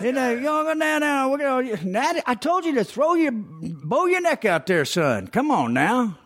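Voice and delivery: very high Southern coach voice; imitated coach, high Southern twang; high-pitched Southerner. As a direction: squeal it like a Southern baseball coach. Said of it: "one of those high-pitched Southerners".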